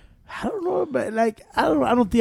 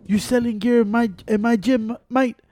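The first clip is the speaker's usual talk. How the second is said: higher-pitched British bloke confronting someone; mock British accent, pitched up; 5 semitones higher than usual. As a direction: imitate a UK tough in a raised British voice. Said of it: "The U K guy".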